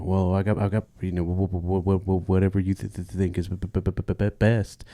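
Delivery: acted stammer, repeating the first sounds; bad stutter. Patient agreeing to the doctor's plan, stuttering badly. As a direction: trip over word starts and restart. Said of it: "he's got a real bad stutter".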